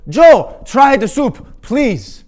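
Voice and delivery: very high, loud begging scream; old man screeching please; shrieking plea. Retold or imitated. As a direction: beg him at full volume. Eric pleading at peak.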